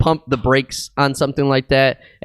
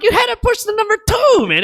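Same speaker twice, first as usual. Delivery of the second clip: exasperated shout. Retold or imitated. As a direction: yell it in disbelief at the caller. Exasperated at accident caller.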